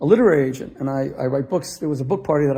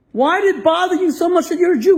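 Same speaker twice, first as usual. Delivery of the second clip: higher and louder, demanding; rising passion; 14 semitones higher, 5 dB louder than usual. Retold or imitated. Rabbi to student, a higher, louder demanding repeat. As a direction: push the question home with full force.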